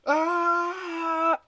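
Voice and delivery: sudden yelp jumping way up in pitch; a quick startled cry at the screen; high startled yelp. Real, not acted. Gamer yelping at something in the game, startled.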